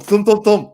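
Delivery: loud, high pounding thump sounds; imitated thumps. Passenger mimicking the thuds under his feet, loud and pounding. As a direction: bang out each thump like a body hitting metal.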